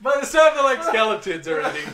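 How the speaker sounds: high, loud laughing narration; laughing hard while telling it; barely holding it together. Real, not acted. Laughing storyteller climax.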